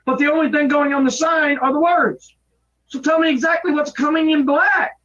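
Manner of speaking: angry shouting. Real, not acted. Frustrated worker yells at coworker.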